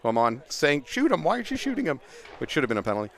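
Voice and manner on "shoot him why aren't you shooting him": imitating a captain yelling from the sidelines, high-pitched; a mock yell from the sidelines, pitch pushed higher; retold sideline yelling. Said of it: "screaming at me from the sidelines".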